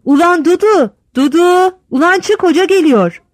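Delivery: high, loud hollering down the stairs; yelling to wife. A narrator's reading. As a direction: bellow for his wife to come out. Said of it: "hem karısına bağırıyor".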